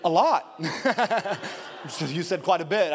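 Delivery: breaks into laughter mid-answer; laughing evasion. Retold or imitated. Husband caught not listening to his wife, laughing evasive answer. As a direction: dodge the question, laughing through it.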